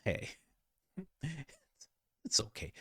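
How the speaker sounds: usual speaking voice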